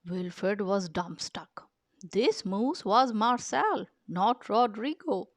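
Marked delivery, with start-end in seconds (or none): none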